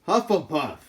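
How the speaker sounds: hat proclaims Hufflepuff; hat announcing. Acted. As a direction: call the house out to the hall.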